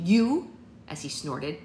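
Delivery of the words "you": loud, higher scoffing; scornful. A narrator's reading. Daughter scoffs at her mother's claim. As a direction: scoff at the very idea.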